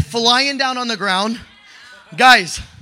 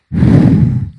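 strong unvoiced breath blown out; imitating God breathing into him, loud breath; loud blowing breath; 7 dB louder than usual. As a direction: blow air out hard like a gust. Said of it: "I see the Father blowing".

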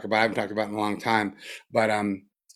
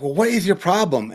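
a higher, exasperated question; mock mad challenge; 7 semitones higher, 3 dB louder than usual. Teacher recalling being mad at his mind, mildly exasperated. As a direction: poke at your own mind, irritated. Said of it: "being mad and be like".

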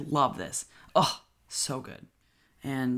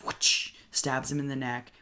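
ordinary delivery

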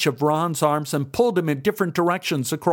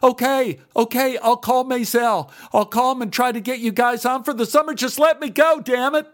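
desperate; 11 semitones higher than usual. A narrator's reading. Ron giving in, desperate.

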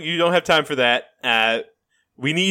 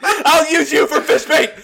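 mock threat; 11 semitones higher, 5 dB louder than usual. Job threat continues.

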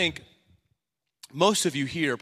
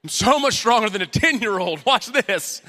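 show-off boast; 5 semitones higher, 6 dB louder than usual. Retold or imitated. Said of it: "I just wanna show off".

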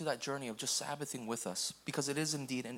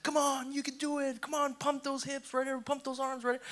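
much higher, cheering gym coach; pumped coach; 9 semitones higher than usual. Retold or imitated. Passionate CrossFit veteran calling a newcomer upward, high cheering. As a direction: hype up a struggling newcomer. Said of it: "there's a guy who's super passionate".

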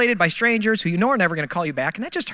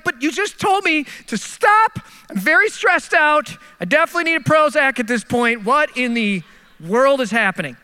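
high, slowed, stressed-out pleading; frazzled protest; 4 semitones higher than usual. Retold or imitated. Driver pleading with shouting worker, high frazzled protest. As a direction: plead on the edge of losing it. Arguing back with airport worker.

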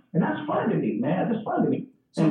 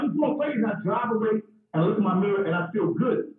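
ordinary talk, nothing marked

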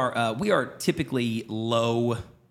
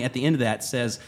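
ordinary delivery

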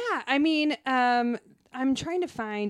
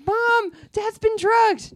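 put-on high, loud, slow little girl; Lisa voice; 14 semitones higher, 9 dB louder, much slower than usual. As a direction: squeal it like a kid. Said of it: "It's like".

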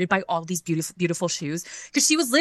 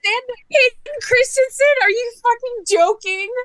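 very high, loud, stretched-out squeal; incredulous fan shriek; 7 dB louder than usual. Real, not acted. Fan hearing the convention guest list, incredulous shriek. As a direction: shriek it in thrilled disbelief.

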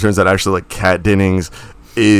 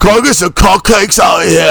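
put-on high, loud, terrible British accent; fake British accent; 9 semitones higher, 5 dB louder than usual. Co-host mocking actors' fake accents, loud high British accent. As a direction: bellow it in an over-the-top British accent. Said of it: "fake British accents".

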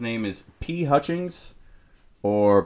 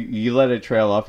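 ordinary talk, nothing marked

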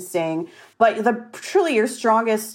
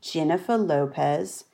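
Australian accent; 4 semitones lower, 6 dB quieter than usual. Shown as a demonstration. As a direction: ease into the Aussie sound. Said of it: "This is how I get into it".

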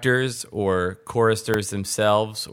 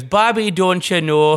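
higher, louder Minnesotan mom scolding; Minnesotan cartoon mom; 7 semitones higher, 4 dB louder than usual. Host imitates a Minnesotan cartoon mom scolding Bobby, in a high, loud Minnesota accent. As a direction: play a Minnesota cartoon mom calling her son. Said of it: "Mom was from Minnesota, and she goes".

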